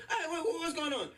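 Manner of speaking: high, startled dad voice with a laugh. Retold or imitated. Son imitates dad walking in, high and surprised. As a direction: burst in as a confused dad. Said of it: "My dad comes in".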